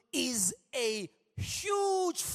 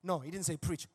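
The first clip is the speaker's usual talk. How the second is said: ordinary delivery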